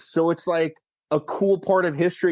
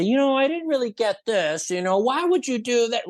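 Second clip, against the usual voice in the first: mock critic voice; 9 semitones higher than usual. Imitating critic at Q and A.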